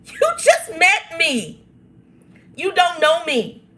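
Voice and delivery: high, slow, indignant retort; the other [name]. Acted. Answering the cousin's texts, high and indignant. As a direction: let the fed-up side take over. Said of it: "the other [name]'s coming out".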